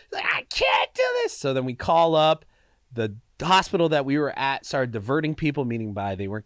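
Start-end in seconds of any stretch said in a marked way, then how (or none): 0.3-1.4 high strained cry of pain; pained yell. Retold or imitated. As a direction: mimic a woman in labor pain. Girlfriend in labor pain, quoted.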